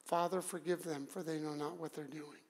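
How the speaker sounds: very soft, low murmur; hushed prayer. Acted. Jesus praying as the soldiers ignore him, hushed and low. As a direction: let the plea almost vanish.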